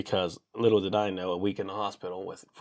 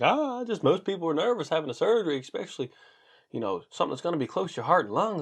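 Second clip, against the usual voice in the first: higher-pitched, lilting doctor imitation; mock doctor voice; 8 semitones higher than usual. Doctor explaining surgery nerves to patient, higher mock voice. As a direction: play a rambling doctor in a raised pitch. Doctor's quoted reply, voice put on.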